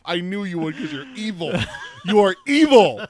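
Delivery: real laughing mock outrage; laughing accusation. Real, not acted. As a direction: jokingly call him evil mid-laugh.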